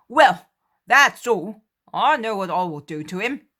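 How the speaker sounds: threatening whoever hurts the master; protective Peter. Acted. Peter vowing to the others to punish the culprit, loud.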